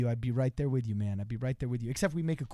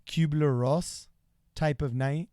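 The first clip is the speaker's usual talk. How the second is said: slow puzzled reread; slower than usual. Shown as a demonstration. Rereading the odd chat phrase slowly to Cody, puzzled. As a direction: sound out the phrase slowly, word by word. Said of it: "Is that, like, really expensive alcohol?".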